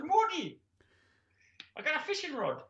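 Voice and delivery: high-pitched mock Geordie; put-on high Geordie woman; comic Geordie accent. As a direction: squeal it in a bad Geordie accent. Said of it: "She's Geordie now".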